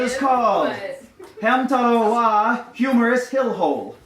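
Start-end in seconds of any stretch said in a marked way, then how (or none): none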